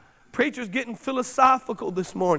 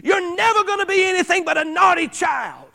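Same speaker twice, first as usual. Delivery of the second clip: retold frustrated outburst; 5 semitones higher than usual. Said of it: "she just cried out in her frustration".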